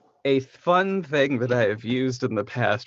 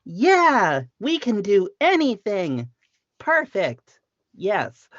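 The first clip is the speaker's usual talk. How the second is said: anime protagonist voice; 8 semitones higher, 4 dB louder, slower than usual. Pretend. Keeps the anime voice, laughing.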